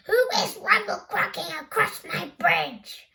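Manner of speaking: a greedy troll demands an answer; grumbling troll. Acted. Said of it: "grumbling the hungry troll".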